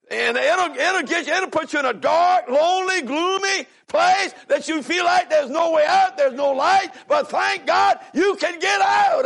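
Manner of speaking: building to a high, emphatic pulpit cry; loud preaching. Real, not acted. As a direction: preach it out loud, drawing out the big words. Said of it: "turn me down if I get too loud".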